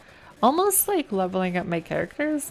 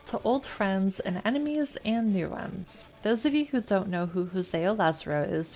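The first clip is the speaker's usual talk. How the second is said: reading game text aloud, evenly; story narration. A narrator's reading. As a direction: narrate the scene like an audiobook. Reads game letter aloud.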